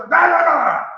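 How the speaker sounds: loud, high mock snapping; impatient outburst. Preacher acting out an impatient person, loud mock snapping. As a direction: blurt it out like someone losing patience. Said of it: "Se arrebata".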